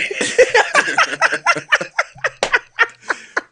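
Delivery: squealing burst of laughter; high-pitched laugh. Real, not acted. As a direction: shriek with laughter.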